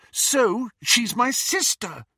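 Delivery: high, loud, slow reproach; upset rebuke. A narrator's reading. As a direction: rebuke him, drawing out each word. Lily upset, rebukes Snape.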